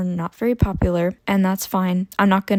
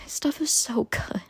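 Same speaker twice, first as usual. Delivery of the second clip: whisper; 3 semitones higher, 8 dB quieter than usual. Real, not acted. Gushing over a beloved book.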